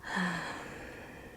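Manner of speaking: long, airy sigh out; relaxing sigh. Shown as a demonstration. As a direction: sigh out slowly to let go. Demonstrates letting go before deep breaths.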